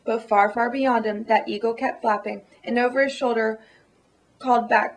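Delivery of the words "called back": ordinary delivery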